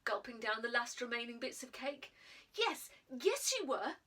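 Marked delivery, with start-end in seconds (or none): 2.5-4.0 slow, louder, pressing each word; boy contradicting the girl, insistent; insisting. Acted. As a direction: insist slowly and firmly. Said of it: "insisted Jack".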